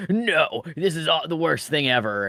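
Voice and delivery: mock outraged parents. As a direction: protest like an overdramatic parent, easing off. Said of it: "the parents are just like".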